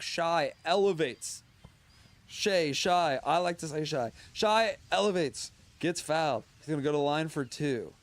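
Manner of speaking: excited sports announcer call; play-by-play. Acted. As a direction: call the game like live TV.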